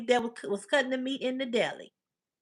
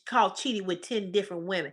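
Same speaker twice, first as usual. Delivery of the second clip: ordinary delivery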